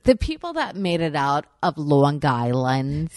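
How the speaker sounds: loud, hammed-up Long Island accent. Mocking hometown people to the co-host, broad Long Island accent. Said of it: "That's the best fucking New York accent".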